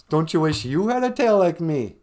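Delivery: high, loud bragging; boastful. Acted. Beaver showing off his tail to the bear, boastful.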